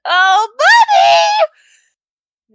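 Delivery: long, high, loud cheer; excited shout. Real, not acted. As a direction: yell it like you just hit the jackpot. Big damage roll result.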